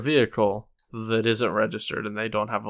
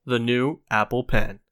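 product announcer; 11 dB louder than usual. Acted.

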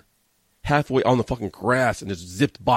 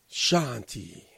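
a softer, drawn-out "Shanti" in a light wrestler impression; Jinder Mahal; 5 dB quieter than usual. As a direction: let the second "Shanti" fade away gently. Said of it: "as Jinder Mahal says".

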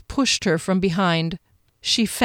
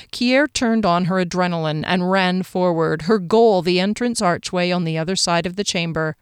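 usual speaking voice

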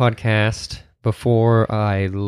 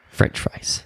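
breathy letdown aside; whispered aside; 5 dB quieter than usual. Real, not acted. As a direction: whisper it, let down. Unvoiced disappointed aside.

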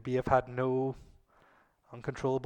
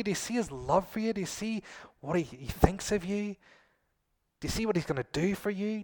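higher and louder, gushing with wonder; excited Spirit voice; 5 semitones higher, 5 dB louder than usual. Acted. The Spirit showing Jesus to believers, thrilled and gushing. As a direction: point at Jesus like it's the best news ever. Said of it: "The Spirit shines on Jesus and says".

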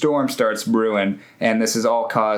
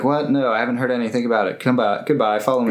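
fast flustered brush-off; much faster than usual. Retold or imitated. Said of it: "she's like".

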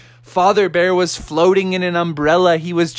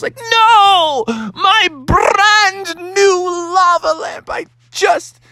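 high, slow, drawn-out mock despair; anguished wail; 13 semitones higher, slower than usual. Bear finding his lava lamp broken, hammed-up wail. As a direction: wail it melodramatically, stretching the words.

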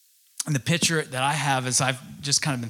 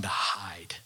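whispered confession; 5 semitones lower, 6 dB quieter than usual. Real, not acted. Confessing shame, drops to whisper.

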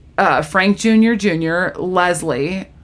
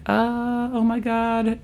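soft, drawn-out mock dismay; hushed dismay; 3 semitones higher, 8 dB quieter, much slower than usual. Rachel reacts to Phoebe wanting one, hushed. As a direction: let it out under your breath.

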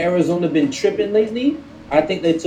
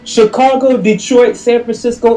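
excited; 6 semitones higher, 4 dB louder than usual. Real, not acted. Animated listing of team records.

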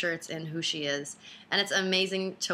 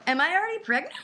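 mock swooning panic; 10 semitones higher, 4 dB louder, faster than usual. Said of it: "am I in love with you?".

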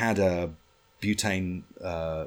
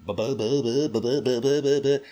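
higher, louder sputtering engine noise; imitated engine sputter; engine imitation; 5 semitones higher, 4 dB louder than usual. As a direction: mimic a misfiring outboard motor.

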